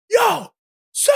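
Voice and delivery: guard calls out high and sharp across the airport; calling out. Retold or imitated. Security guard calling the smuggler back, high call. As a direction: hail him from a distance, high.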